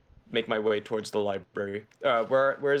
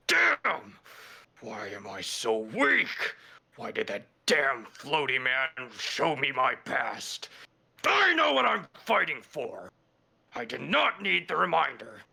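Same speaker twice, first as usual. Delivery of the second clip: pure anger, muttered. Acted. Character pounding a boulder, raging at himself in pure anger. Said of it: "Just pure anger. Just muttering to myself".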